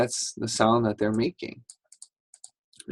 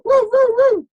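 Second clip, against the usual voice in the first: high, loud howl; dog howl; 9 dB louder than usual. Shown as a demonstration. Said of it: "Sometimes they may go".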